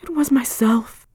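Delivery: whispered, shuddering confession; shuddering cry. Acted. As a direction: breathe it out in a trembling whisper. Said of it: "cried Hester, shuddering".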